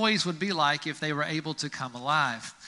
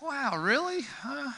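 high, incredulous squeak; can't believe this is the job; a high, let-down squeak; dismayed Q-tip; 9 semitones higher than usual. Pretend. Q-tip disappointed by ear wax.

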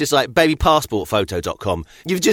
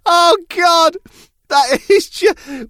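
laughing; 5 dB louder, slower than usual. Real, not acted. Overcome with laughter.

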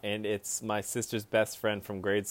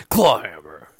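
loud, punchy ad announcer; 9 dB louder, slower than usual. Retold or imitated. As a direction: imitate a hyped-up ad read. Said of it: "your go-to noise for every advertising".

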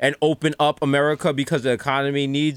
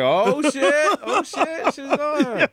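higher-pitched "oh shit" of sudden alarm; startled; 9 semitones higher, much slower than usual. Retold or imitated. Recalling a TV moment, mock alarmed. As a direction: act caught off guard by a shock. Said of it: "I was like".

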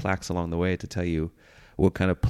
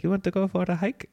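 high-pitched imitation of a friend; put-on high friend voice; friend's eager invitation; 12 semitones higher than usual. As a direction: pitch it up like a friend proposing an outing. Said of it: "my friend always says that".

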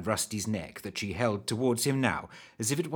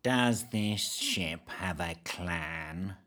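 low, very slow, soft flat drone; monotonous drawl; 5 semitones lower, 6 dB quieter, much slower than usual. Acted. Creepy man questions the travellers, droning drawl. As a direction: drag every word out lifelessly. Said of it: "he drawled, in the most monotonous voice".

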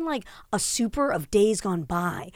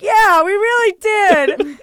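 drawn-out, higher and louder whine; exaggerated weary agreement; 11 semitones higher, 9 dB louder, much slower than usual. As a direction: stretch it out like a long-suffering tenant. Retelling her exhausted reply.

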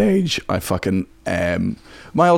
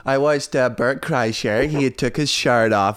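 put-on female American accent. Scot improvising as woman who watched a special, female American accent. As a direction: play an American girl chatting about Netflix. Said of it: "I can only do female American accent".

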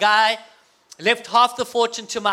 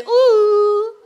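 high, loud, drawn-out swooning ooh; ladies' ooh; 10 semitones higher, 7 dB louder than usual. Shown as a demonstration. Speaker cues ladies about romantic dad, swooning ooh. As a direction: coo it high and long like an admiring crowd of women. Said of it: "Ladies say".

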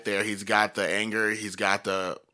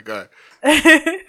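squeaky laughing go ahead; 13 dB louder than usual. Real, not acted. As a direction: laugh through it. Laughing at friend's accent.

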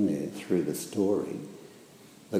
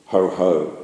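louder gloating ho ho; gloating heffalump; 8 dB louder than usual. A narrator's reading. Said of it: "in a gloating kind of way".

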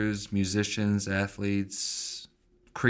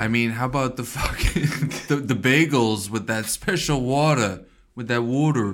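louder, hammed-up New York accent; attempted New York accent; 3 semitones higher, 5 dB louder than usual. Co-host defends New York bagels, in a put-on New York accent. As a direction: argue in a shaky-grasp New York accent. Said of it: "doing a New York accent".

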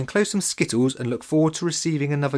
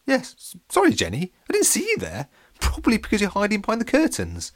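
flustered; 10 semitones higher, 3 dB louder than usual. A narrator's reading. Dad flustered, surprised.

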